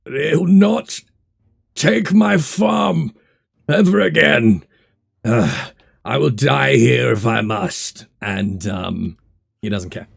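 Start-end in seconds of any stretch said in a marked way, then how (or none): 0.0-8.0 loud, high, defiant slurred cry; slurred shout back. Acted. Wounded botanist refuses to stop fighting, slurred defiant shout. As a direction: slur it out loudly, stretching the words. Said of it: "he kind of slurs back".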